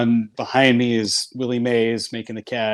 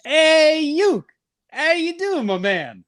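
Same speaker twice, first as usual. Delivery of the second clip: put-on much higher, louder, bright greeting of an old legend; Willie Mays voice; 4 dB louder, much slower than usual. Teller voices Willie Mays greeting Uke on speakerphone, high and loud. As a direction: play the legend calling his old friend, pitched way up. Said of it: "this voice comes on the phone".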